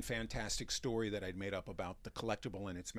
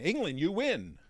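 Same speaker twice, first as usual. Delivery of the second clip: loud, high winner announcement; excited host; 8 semitones higher, 9 dB louder than usual. Retold or imitated. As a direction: call out England like a prize winner. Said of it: "I come over and I say".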